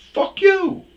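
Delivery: very high, very loud shout; imitated angry driver yell; retold yell from other driver. Other driver's retort in argument.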